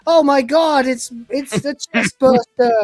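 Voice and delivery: hammed-up panic. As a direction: squeal for help like a panicking cat. Cat sees the chest burster.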